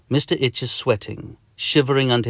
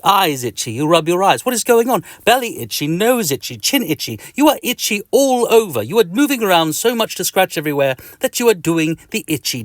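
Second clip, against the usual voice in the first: higher, agitated narration; frantic itching; 8 semitones higher, 4 dB louder than usual. A narrator's reading. Narrator tells a child about the itching fit, frantic. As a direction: narrate as if itchy all over.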